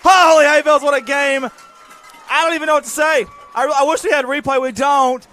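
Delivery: high, loud, breathless raving; euphoric post-score gush. Real, not acted. Announcer raving about the touchdown, loud and elated. Celebrating the touchdown, still keyed up.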